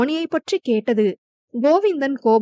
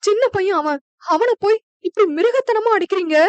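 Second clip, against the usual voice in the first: a mother crying over her hurt son; distraught mother's cry; crying woman pleading; 7 semitones higher than usual. A narrator's reading. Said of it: "என அழுது கொண்டே".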